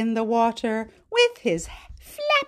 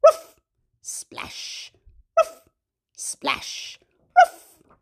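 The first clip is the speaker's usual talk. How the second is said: barking dog; much slower than usual. Pretend. Teacher voices the dog who loves water. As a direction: bark like a happy dog. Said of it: "Dog says".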